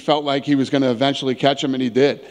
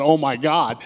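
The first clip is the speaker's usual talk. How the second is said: normal talking voice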